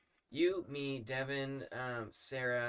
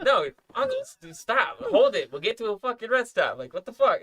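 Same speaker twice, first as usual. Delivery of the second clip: loud, higher exasperated replay; retold exasperation; 9 semitones higher, 15 dB louder than usual. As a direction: protest loudly, as if fending off uncle. Retold pleading with drunk uncle.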